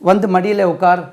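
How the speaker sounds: ordinary talk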